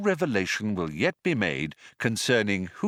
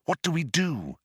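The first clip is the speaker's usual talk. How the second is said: mild nervousness, pitched higher; a touch of worry in a higher voice; understated anxious voice; nervous; 5 semitones higher than usual. A narrator's reading. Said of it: "said Trillian nervously".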